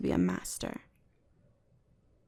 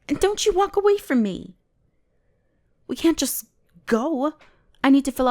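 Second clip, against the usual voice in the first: much higher and louder, scolding; indignant yell; 6 semitones higher, 6 dB louder than usual. Acted. Mage calling after the human walking away, indignant yell. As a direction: shout after someone walking off.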